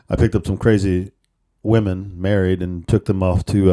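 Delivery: ordinary talk, nothing marked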